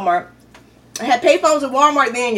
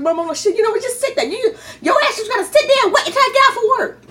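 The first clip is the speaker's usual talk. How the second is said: exasperated mom; 11 semitones higher than usual. Retold or imitated. Mom telling her lost daughter to sit and wait, exasperated and high. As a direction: tell the kid to just sit there, fed up.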